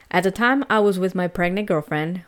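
usual speaking voice

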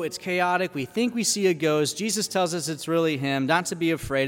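ordinary delivery

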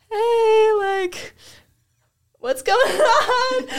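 very high, slow, laughing plea over the radio; desperate laughing call. Retold or imitated. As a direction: whine for help while cracking up.

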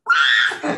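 a loud, high "ah" of shock; startled yelp. Retold or imitated. Karen reacting to her sponsor's order, a loud startled yelp. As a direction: yelp like you can't say no.

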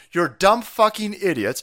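normal talking voice

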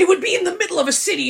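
fast, high and loud; excited. Real, not acted. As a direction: rattle it off excitedly. Excited rant about the plot.

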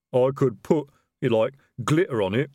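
a troll fumbling for words in his suit. A narrator's reading.

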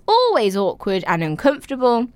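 usual speaking voice

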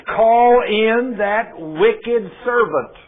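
angry king summoning the servant. Acted. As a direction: summon him with slow anger. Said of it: "the king was angry".

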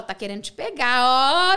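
loud, drawn-out taunt, last word stretched; mock teasing. Colleagues teasing her about a compliment, mocking drawn-out taunt. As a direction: tease like a gossiping colleague.

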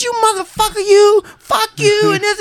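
wife screaming. Retold or imitated. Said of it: "screaming these insanities".

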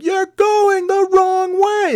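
high, slow, put-on voice; movie impression. Stranded men quote the movie, high put-on voice. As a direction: mimic the movie line.

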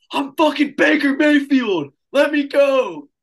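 high, loud, slow yelled protest; shouting to be let go; mock shout. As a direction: yell it while pinned down. Drunk Baker shouting while tackled.

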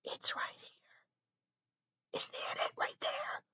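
slow whisper, very close to the keyboard; whisper into the mic. Shown as a demonstration. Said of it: "when I talk right into the keyboard".

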